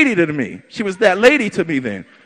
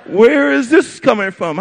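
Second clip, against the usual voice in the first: bemused laugh; 7 semitones higher, much slower than usual. Retold or imitated. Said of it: "she's looking at me like".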